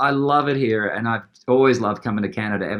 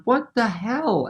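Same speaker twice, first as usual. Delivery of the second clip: softer, slow stunned "What the hell?"; dazed backstage, barely believing; muttered disbelief; 9 semitones higher, 5 dB quieter, slower than usual. Retold or imitated. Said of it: "I'm backstage going".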